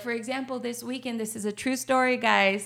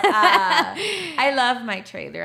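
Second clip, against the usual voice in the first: laughing fondly; 4 semitones higher, 9 dB louder, slower than usual. Real, not acted. Fond laughing declaration.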